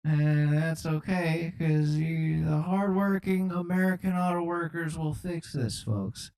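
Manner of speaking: put-on comic elderly politician; Joe Biden. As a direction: reassure the nation as a doddering president. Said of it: "I'm just Joe Biden on the track".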